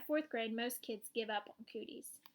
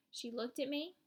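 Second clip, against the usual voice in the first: usual speaking voice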